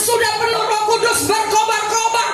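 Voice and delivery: high, sustained fiery proclamation; full-force preaching. Real, not acted. Preacher urging the congregation to spread the Gospel, full-force. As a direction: proclaim it with burning zeal, pitched high.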